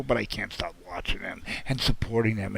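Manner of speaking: ordinary talk, nothing marked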